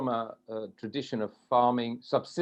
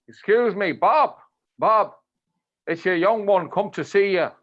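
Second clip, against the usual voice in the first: higher, louder call across a field; retold shout; 6 semitones higher, 5 dB louder than usual. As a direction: shout out to a man hidden in the hedge. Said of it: "he shouts".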